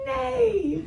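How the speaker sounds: high-pitched imitated whinny; horse neigh. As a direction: neigh like a child playing horse. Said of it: "neigh at them".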